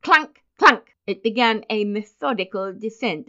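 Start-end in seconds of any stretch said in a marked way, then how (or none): 0.0-0.9 two high, loud spoken clunks; imitated clunks. Narrator sounding the basin descending the stairs, loud clunks. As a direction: punch out each clunk separately.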